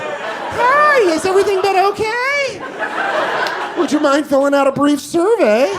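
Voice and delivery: high-pitched, sugary-polite service rep; customer service voice. Retold or imitated. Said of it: "I get, like, customer service voice".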